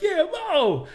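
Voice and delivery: high, loud cheering shout; crowd cheer. Retold or imitated. A second fan joining in for Mo, a loud high cheer. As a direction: whoop it like a fan starting a chant. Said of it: "And this guy goes".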